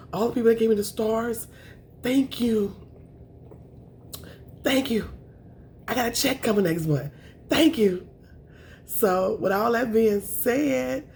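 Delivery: high, overwhelmed gratitude; holding back tears. Real, not acted. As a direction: voice lifts with emotion, fighting tears. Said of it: "I don't wanna cry".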